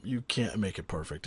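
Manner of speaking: ordinary delivery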